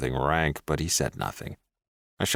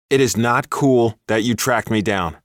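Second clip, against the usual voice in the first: angry; 5 semitones higher, 6 dB louder than usual. A narrator's reading. Angry at stalker ex.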